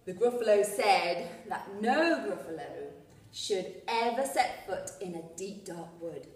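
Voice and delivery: storybook narration. A narrator's reading. Teacher reading the Gruffalo book to learners, story narration. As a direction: paint the dark wood for little listeners.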